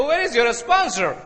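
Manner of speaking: slow mock American accent. MD questioning the applicant, slow American accent. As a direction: imitate an American boss with stretched vowels. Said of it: "American accent था".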